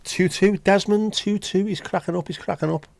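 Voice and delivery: high, giddy crowing about a two-all score, pitched well up; gleeful gloating. Real, not acted. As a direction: crow it like a delighted rival fan. Excited gloating over the score.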